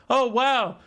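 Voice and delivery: sarcastic fake excitement about another identical game; sarcastic caster hype. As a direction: gush with fake excitement over a stale replay. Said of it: "so many people are tired of watching".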